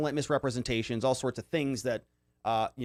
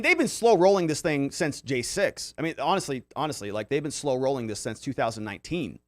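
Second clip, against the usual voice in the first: usual speaking voice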